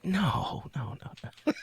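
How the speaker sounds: whispered no, no, no, trailing away; mock dismay. Host retells catching a bird app's mistake, hushed mock dismay. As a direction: retell your horror at the app under your breath. Reacting to wrong bird app.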